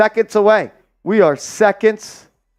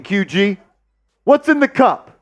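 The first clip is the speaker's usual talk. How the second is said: usual speaking voice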